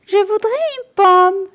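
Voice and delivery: high polite little customer; petit homme. A narrator's reading. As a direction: ask politely in a tiny high voice. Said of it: "dit-il".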